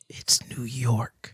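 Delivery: slow, breathy-free whispered emphasis on It's New York; hushed whisper. Real, not acted. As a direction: drop to a slow secret whisper. Repeats phrase, hushed for emphasis.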